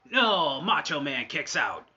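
loud shout, high on no; excited. Acted. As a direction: shout it, thrilled. Kickout in commentary.

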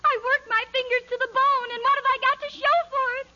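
hammed-up wailing woe; overdone tragic complaint; melodramatic lament. Princess laments her drudgery.

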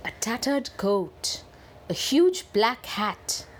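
usual speaking voice

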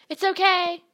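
loud, high call up to a friend. A narrator's reading. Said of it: "he called up".

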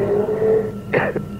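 breathy, weak rattling cough; dying cough. Acted. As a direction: cough like a man at his last.

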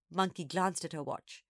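usual speaking voice

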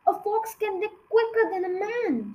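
high-pitched, eager exclamation; mild excited shout. A narrator's reading. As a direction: call out with rising excitement. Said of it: "shouted Mister Fox".

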